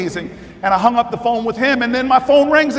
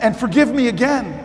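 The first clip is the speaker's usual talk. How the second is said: ordinary delivery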